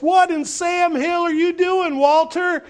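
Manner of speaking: put-on high, loud shocked little girl's cry; outraged girl. Scout yells across the dinner table at Walter, high and loud. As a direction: blurt it out like a scandalized tomboy. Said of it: "She says".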